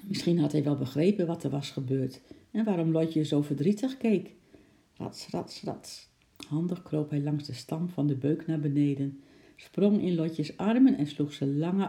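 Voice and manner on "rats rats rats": soft, whispered rats sounds; whispered scrabbling effect. A narrator's reading. Narrator imitating the chimp climbing down, whispered effect. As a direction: whisper it like claws on bark.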